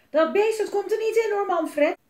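high, loud shriek at a husband. A narrator's reading. Mama forbids papa to let the beast in, shrieking. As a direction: shriek it out as an outraged mother. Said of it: "gilde mama naar papa".

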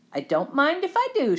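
put-on high, lilting little-girl storybook voice; Goldilocks. Goldilocks helping herself to a seat, high storybook voice. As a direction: pitch it up, pleased to help herself. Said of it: "she said".

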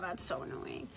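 soft unvoiced grumble; quietly grumbling to herself; muttered annoyance. Real, not acted. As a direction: mutter it under your breath. Muttering after mishap.

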